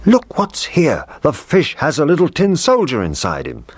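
high-pitched amazed cook; excited discovery. A narrator's reading. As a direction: exclaim over a surprising find, high. Cook exclaims on finding the soldier.

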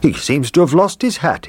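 high cheerful cook voice. Acted. Cook examining the cleaned soldier, high voice. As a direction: cook looking the soldier over. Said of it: "he said".

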